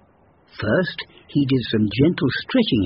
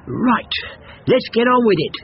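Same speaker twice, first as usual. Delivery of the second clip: high, eager and pushy; impatient hare voice; 8 semitones higher than usual. Acted. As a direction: hurry things along. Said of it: "said the hare".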